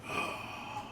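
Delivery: long, soft breathy exhale; slow airy sigh, all breath; sigh of release. Acted. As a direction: let out a dead man's last sigh. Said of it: "you hear this kind of".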